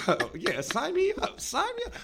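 high, squeaky sign me up; hammed-up eager yelp. Eager joke repeat.

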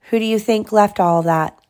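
ordinary delivery, nothing marked

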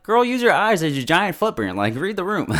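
high, loud, exasperated; exasperated scolding. Real, not acted. Viewer scolding May for missing the footprint, exasperated. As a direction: tell her off for missing it.

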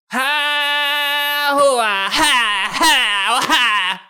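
very high, strained haka-style yells; mock war-dance shouts. Dancing friend doing a rugby team war dance, high yells.

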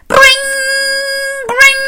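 loud, high rolled-r ring; ringing alarm clock. Acted. Storyteller sounding the alarm clock, loud ringing trill. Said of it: "went the alarm clock".

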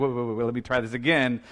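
rapid, low-key backtracking; flustered restart. Acted. Gabriel starting over after frightening Mary, hurried backtracking. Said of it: "He's like".

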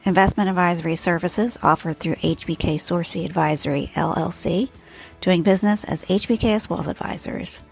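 smooth radio announcer; announcer read. Acted. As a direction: read it like a podcast outro. Recorded outro announcer read.

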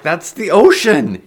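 slow, high, alarmed shout; shouting back. A narrator's reading. Heron sees the ocean below, shouting back. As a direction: shout the objection over wind. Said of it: "she s-, shouted back".

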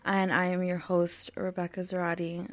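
ordinary talk

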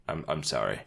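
low, quiet, sheepish apology; sheepish Ron Burgundy. Retold or imitated. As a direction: mumble it like a scolded man. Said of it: "He's like".

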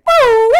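high, loud siren whoop; sudden piercing mimicked siren blip; imitated police siren. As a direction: whoop like a squad car siren. All of a sudden we hear it.

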